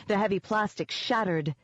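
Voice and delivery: usual speaking voice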